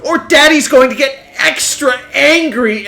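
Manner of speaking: high, loud, slow hammed-up whiny yell; angry daddy meme voice. Streamer voicing the Roblox daddy chasing his kitten, squeaky hammed-up threat. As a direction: squeal the threat like a cartoon boy trying to be scary. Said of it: "he says it just like that".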